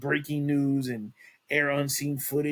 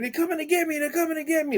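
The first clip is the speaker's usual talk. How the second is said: much higher, panicked mock scream; a hammed-up paranoid cry, pitched way up; imitating frantic screaming; 14 semitones higher, faster than usual. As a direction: voice a terrified man yelling in the street. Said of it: "like, screaming".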